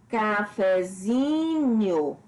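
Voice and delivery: "cafezinho" very slow, ZI longest, fe secondary; stress on ZI. Shown as a demonstration. Teacher showing a derived word, slow "cafezinho" stressed on ZI. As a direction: stretch "cafezinho", leaning on zi with lighter weight on fe. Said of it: "a sílaba tônica zin e o fé".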